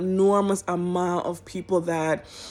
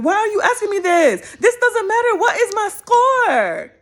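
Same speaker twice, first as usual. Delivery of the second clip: high, loud frustrated demand; half-laughing high outburst at a survey; exasperated mock shout; 13 semitones higher, 6 dB louder than usual. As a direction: shout at the screen in disbelief. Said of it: "I'm answering those questions like".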